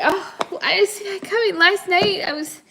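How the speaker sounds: hammed-up shock; mock dismay. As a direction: play it up like you got blindsided. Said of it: "you're like".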